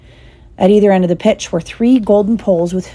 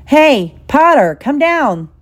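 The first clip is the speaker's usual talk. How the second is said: high, drawn-out call from afar; 8 semitones higher, 4 dB louder, slower than usual. A narrator's reading. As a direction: call up to someone flying overhead. Calling up to a flier.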